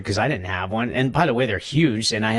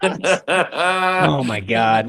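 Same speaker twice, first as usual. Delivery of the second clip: laughing; 3 semitones higher, much slower than usual. Real, not acted. Laughing at the memory.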